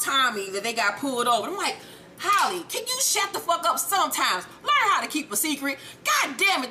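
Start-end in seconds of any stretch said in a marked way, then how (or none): 2.2-6.7 high, punchy mock telling-off; exasperated scolding. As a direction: scold a blabbermouth friend, voice jumping up. Scolding the big-mouthed friend.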